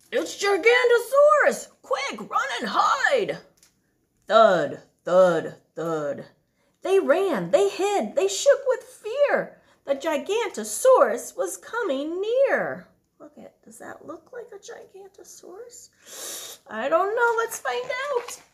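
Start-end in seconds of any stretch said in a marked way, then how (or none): 0.1-3.4 high loud warning shout; cry of alarm. Acted. Bonehead crying out a warning to his friends. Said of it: "Bonehead cried". 4.3-6.3 low, loud booming footfalls; heavy thuds. Acted. Giant footsteps approaching the hiding dinosaurs. 13.2-16.6 hushed higher aside; whisper. Real, not acted. Parent quietly asking child about the picture.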